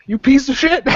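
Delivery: menacing yell. Acted. Guard yells at Jacob to defend the women, menacing. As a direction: bark it at him like a protective bouncer. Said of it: "yells at you and points at you, menacingly".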